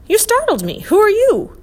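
high, loud startled outburst; loud, high-pitched cry of alarm. A narrator's reading. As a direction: jump with fright, pitch shooting up. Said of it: "The oink jumped".

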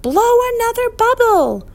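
high, loud desperate yell; high-pitched alarmed shout; urgent shout. A narrator's reading. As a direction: shout it up to someone falling. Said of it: "the professor shouted".

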